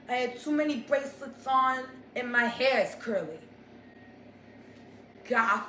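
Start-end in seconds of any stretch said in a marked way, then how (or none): none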